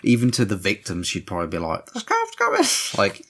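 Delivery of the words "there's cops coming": very high, squeaky warning; mock warning cry. Imagining her alerting even the victims, mock warning cry. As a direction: squeal it high at normal volume.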